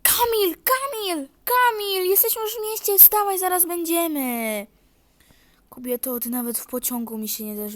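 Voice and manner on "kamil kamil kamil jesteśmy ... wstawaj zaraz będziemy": wife calling Kamil to wake up. Acted. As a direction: call him awake. Wife wakes husband.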